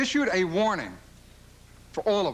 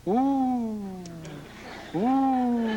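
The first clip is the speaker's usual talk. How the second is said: tennis fan coo; 4 semitones higher than usual. Retold or imitated. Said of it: "they go".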